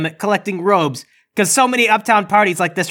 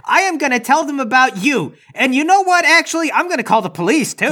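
entitled customer; 6 semitones higher than usual. Acted. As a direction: threaten like an indignant customer. Threatens to call police.

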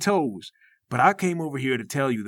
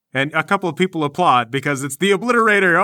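ordinary talk

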